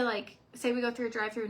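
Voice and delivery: normal talking voice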